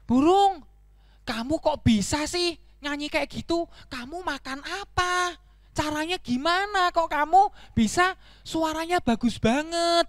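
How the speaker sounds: high, eager questioning; high-pitched storybook donkey, curious. Acted. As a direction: ask in wide-eyed admiration.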